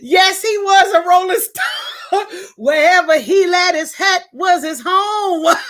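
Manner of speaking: half sing it out, laughing; laughing shout. Real, not acted. Echoing a viewer's song line about her dad, shouted high with laughs.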